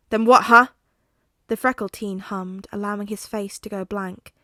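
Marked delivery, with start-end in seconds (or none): 0.1-0.7 loud snapping demand; angry demand. Acted. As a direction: bark the question. Katsuki demanding.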